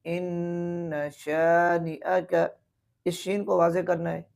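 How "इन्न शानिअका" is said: इन्न with a long nasal nun; very slow इन्न शानिअका; nasal ghunna. Shown as a demonstration. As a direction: hum the nun of इन्न slowly. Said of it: "यहाँ भी गुना है".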